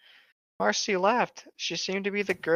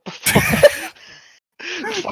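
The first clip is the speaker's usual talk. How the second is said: slow, loud, laughing line; laughing through it; 4 semitones higher, 6 dB louder, much slower than usual. Real, not acted. Joke ad-lib.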